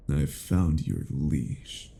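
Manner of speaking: ordinary talk, nothing marked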